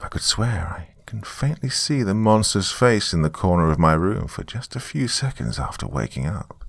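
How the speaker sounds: usual speaking voice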